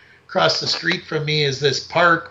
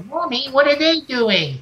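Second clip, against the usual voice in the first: put-on high, small child asking innocently; child's voice; 9 semitones higher than usual. Child in stroller asks mother about junkies, high innocent voice. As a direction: play the curious little kid in the stroller. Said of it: "some young mother with her ch-, kid in a stroller".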